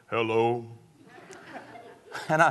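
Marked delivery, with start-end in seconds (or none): 0.1-0.7 a hint of a low, hoarse sick wife's hello. Husband imitating his sick wife's low hello. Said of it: "her voice was way down there".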